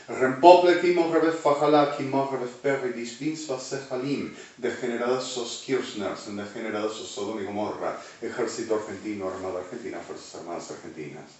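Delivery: loud burst that fades and quickens at the end; fiery denunciation. Acted. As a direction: rattle off the names of the condemned. Denunciation in Spanish and tongues.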